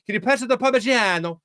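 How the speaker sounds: mock Italian flourish on the cheese's name; outrageous Italian accent. Host mocking non-Italian speakers, hammed-up Italian accent. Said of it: "wildly outrageous Italian accents".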